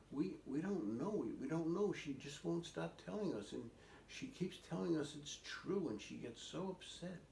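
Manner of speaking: lightly voiced children at a loss; worried siblings. Siblings to the old man about their sister, mildly flustered. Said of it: "And they said".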